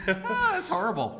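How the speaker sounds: laughing. Real, not acted. Co-host mocking the host's bad Australian accent, laughing hard. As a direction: crack up while teasing.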